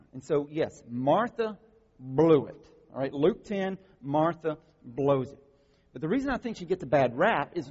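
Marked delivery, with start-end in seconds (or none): none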